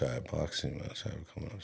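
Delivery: put-on nervous kid mumbling to his mom; mumbled under breath. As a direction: slip it out fast and quiet. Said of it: "What are you talking about?".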